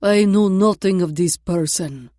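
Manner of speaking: put-on loud, deliberate Russian accent. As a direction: announce it loud for others to hear. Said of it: "said louder than necessary".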